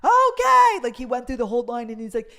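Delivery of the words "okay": sudden high, loud bark of okay; guard's yell. Retold or imitated. Security guard yelling to start the crowd. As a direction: yell it out to the whole line. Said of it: "he starts screaming".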